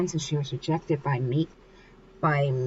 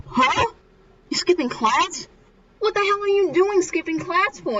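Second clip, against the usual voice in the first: high-pitched retold astonishment; indignant disbelief; 11 semitones higher than usual. Mika catching someone skipping class, mock shocked and indignant. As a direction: act out a scandalised, incredulous reaction. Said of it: "Mika's like".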